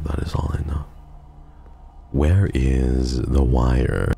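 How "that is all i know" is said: soft breathy whisper. Shown as a demonstration. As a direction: whisper it like a secret. Repeated line said again whispered.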